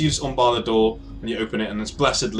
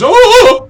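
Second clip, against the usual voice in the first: very high, loud scream; startled shriek; 17 dB louder than usual. Acted. Woman in bed seeing intruders, startled shriek. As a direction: shriek at the sight of a stranger.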